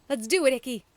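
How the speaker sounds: rally your partner; eager shout. A narrator's reading. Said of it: "Medaby snapped".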